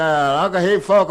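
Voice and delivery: a sudden loud yell of surprise; retold yell. Yelling at his uncle after being kicked. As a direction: yelp it out after the cow kicks you. Cow kicks him over, he yells.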